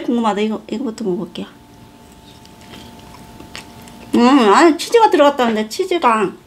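words between bites; talking while chewing. Real, not acted. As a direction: mouth partly full of bread. Seen 0: Bites bread at -3s, lips working and chewing while talking.

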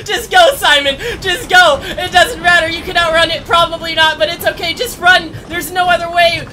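high, loud panicked urging; terrified. Real, not acted. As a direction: yell at Simon to run. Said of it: "I'm so terrified right now".

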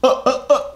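high staccato "uh" grunts; exclamation-point noises. Acted. Voicing her exclamation points, high staccato noises. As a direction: punch out short high-pitched uhs. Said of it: "was the exclamation point".

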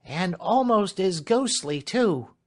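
high, loud, drawn-out maid's voice; uneasy servant. Acted. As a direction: stretch it out high, spooked by the stillness. Clemency peering into the dark.

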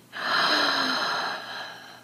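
long audible meditative inhale; big, demonstrative inhale; deep breath in. Shown as a demonstration. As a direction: breathe in deeply for listeners to follow. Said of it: "Breathe in".

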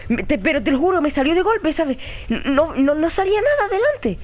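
high-pitched, rattled insisting; shaken protest. Real, not acted. Player swearing nothing was there before the scare, shaken. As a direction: stammer it out, climbing in pitch.

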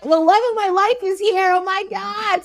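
high, giddy gushing; acted excitement. Prince reunited with his lost love, acted giddy excitement. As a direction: gush it high, as if losing it with joy. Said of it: "He's so excited".

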